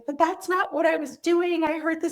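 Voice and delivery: put-on high-pitched, tearful protest of a hurt child; imitating a daughter crying that she meant no harm; upset daughter in tears. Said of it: "she just burst into tears and got so upset".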